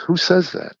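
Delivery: wife's incredulous comeback, re-enacted; retold indignant challenge. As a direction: challenge it as an affronted wife would. Wife challenges him in retold quarrel.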